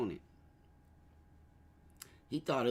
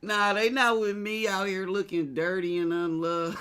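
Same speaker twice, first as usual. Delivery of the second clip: mock Trump; 6 semitones higher, 5 dB louder than usual. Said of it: "imitating Trump disowning them".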